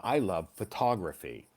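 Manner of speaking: photography now fuh-TOG-ruh-fee, stress on TOG; learner's corrected photography, stress on TOG. Shown as a demonstration. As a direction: learner fixes photography to pho-TOG-ra-phy. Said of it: "I need to correct that".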